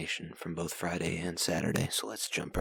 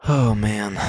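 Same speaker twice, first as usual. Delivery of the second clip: loud exclamation; 2 semitones higher, 12 dB louder than usual. Acted. Hyped podcast opening.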